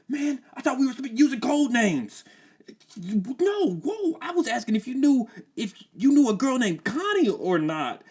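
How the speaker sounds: very high, fast, frantic voice; panicked teen. Acted. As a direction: freak out and backpedal.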